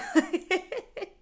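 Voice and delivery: a burst of high real laughter; sudden loud laugh at the absurd question. Real, not acted.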